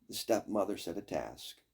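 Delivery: plain reading voice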